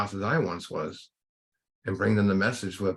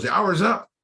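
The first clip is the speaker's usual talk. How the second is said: curt therapist; 11 semitones higher, 6 dB louder than usual. Retold or imitated. Therapist ends session abruptly.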